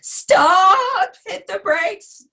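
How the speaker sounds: high panicked shout, then slow; screaming. Retold or imitated. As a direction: yell at a teen driver.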